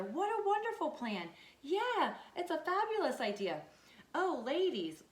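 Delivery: high, loud gushing delight; excited hens. Acted. Hen squealing with delight to the other hens, excited. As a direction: gush like thrilled hens over a grand plan.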